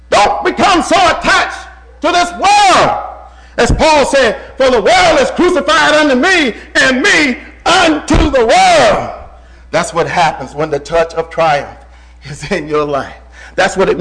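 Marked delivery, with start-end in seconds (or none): 0.1-9.1 higher, louder, slow exhortation; preaching at full force. Acted. Preacher warning the flock against the world, in full-force preaching. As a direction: hammer the warning home.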